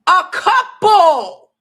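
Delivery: loud, high yelling; shouting. Real, not acted. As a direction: yell it back at them. Yelling at chat about degrees.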